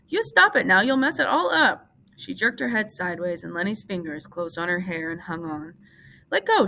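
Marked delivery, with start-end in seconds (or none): 0.1-1.8 high, loud angry cry; furious outcry at Lenny. A narrator's reading. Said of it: "she cried angrily". 6.3-6.7 shrill high cry, much higher and louder; panicked plea to let go; frightened cry. A narrator's reading. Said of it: "she cried".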